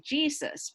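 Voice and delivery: English pronunciation of Jesus; Jesus with English first-syllable stress. Retold or imitated. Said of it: "predicadores en inglés siempre hablan de Jesús".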